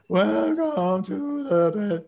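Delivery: high, slow imitation of a frightened official; scared Jens Stoltenberg. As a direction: stretch it out high like a man terrified at a press conference. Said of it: "he was talking like this".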